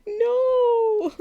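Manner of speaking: high, drawn-out mock-dismayed "no"; dismayed cry. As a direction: wail it in comic dismay. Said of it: "I'm like".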